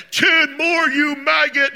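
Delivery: high, loud barking order at the lifter; drill-sergeant yell. Retold or imitated. Workout partner yelling at the exhausted lifter: loud, high drill-sergeant bark. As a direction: bark it like a boot-camp spotter. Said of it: "he's, like, yelling at you".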